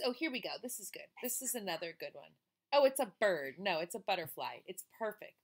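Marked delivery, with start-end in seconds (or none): none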